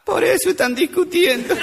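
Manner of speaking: high-pitched mock crying child; hammed-up tearful child voice; tearful little girl. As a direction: wail it like a little girl for the punchline. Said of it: "Estaba una chiquita llorando en la plaza".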